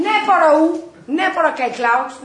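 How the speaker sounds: loud, slow, high bragging; bold boast. Retold or imitated. Impulsive teacher bragging to trainers, slow and loud. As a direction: stretch each word out like a dare.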